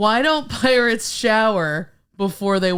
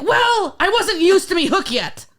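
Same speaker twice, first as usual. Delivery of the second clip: high pirate punchline; 8 semitones higher, 4 dB louder than usual. Acted. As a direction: deliver it as the pirate.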